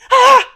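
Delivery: short high, loud yelp of shock; sudden sharp pained scream, re-enacted; retold scream. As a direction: cry out as if jolted by sudden pain. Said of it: "and I screamed".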